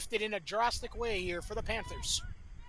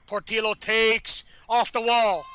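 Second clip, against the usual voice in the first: louder call of the free kick; excited call; 7 dB louder than usual. Real, not acted. Commentator calling the free kick into the wall, excited.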